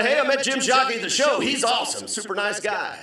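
high, quick, excited report; gushing fan. Retold or imitated. Fan telling folks at home, gushing excitedly. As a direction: brag it brightly and fast.

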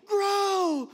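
loud, high cheering yell; yelling at a seed. Retold or imitated. Counselor has Mike yell encouragement at a seed, loud high yell. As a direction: cheer the seed on like a coach at a race. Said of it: "Keep yelling at it".

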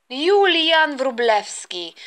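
slow, drawn-out full-name reprimand; stern scolding. Acted. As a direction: draw out the name as a warning. Mother scolds son by full name.

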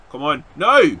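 loud, rising shout of no; frustrated cry. Real, not acted. Gamer urging his wrestler then failing, loud frustrated cry. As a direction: burst out in protest. Move fails in match.

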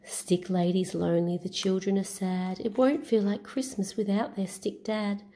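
plain reading voice

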